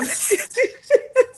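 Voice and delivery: high, rising burst of laughter; giggling fit. Real, not acted. Host apologising to cousin about wanting wine, giggling.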